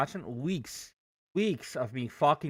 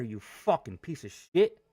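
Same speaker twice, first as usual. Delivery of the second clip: ordinary delivery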